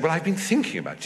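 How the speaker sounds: high, quick imitation of an aristocratic lady; Duchess of Devonshire. Duchess advising the visiting actors, imitated high and brisk. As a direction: play the duchess, pitched well above your own. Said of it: "she said".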